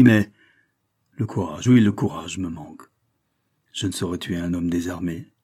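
usual speaking voice

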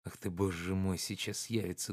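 soft, hurried inner worry; a quiet anxious aside; anxious thought. A narrator's reading. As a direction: think it to yourself in dread. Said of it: "думал Обломов".

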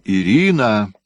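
a second loud call of her name; calling out again. A narrator's reading. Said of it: "Фёдор крикнул".

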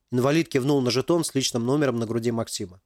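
plain reading voice

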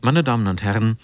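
normal talking voice

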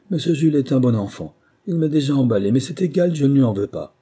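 loud nervous chatter; nervous eager reassurance. A narrator's reading. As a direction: win the officer over. Relieved husband pleads.

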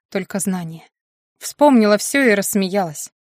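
ordinary delivery, nothing marked

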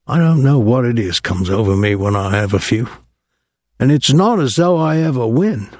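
lightly voiced boozy seaman; a touch of self-mocking swagger; rueful sailor voice. A narrator's reading. Pinky thanks Thomas, rueful.

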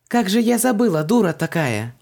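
high, loud exclamation; excited self-reproach. A narrator's reading. Heroine suddenly remembers the amulet, excited self-reproach. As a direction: burst out, cursing yourself.

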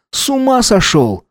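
high-pitched shocked question; incredulous outrage. A narrator's reading. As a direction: throw it out high, appalled. Stunned partner reacts to bite.

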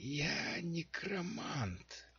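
high, slow, raspy whisper from the dark; hoarse whisper. A narrator's reading. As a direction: rasp it out like a hidden necromancer. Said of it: "продолжал голос". (хриплый шёпот из темноты)

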